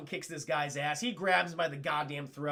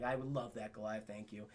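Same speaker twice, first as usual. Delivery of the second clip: low, very soft murmur; tender thanks; 9 semitones lower, 11 dB quieter than usual. Retold or imitated. Elisa accepts Goliath watching over her, tender thanks. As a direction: fade down gently into grateful quiet.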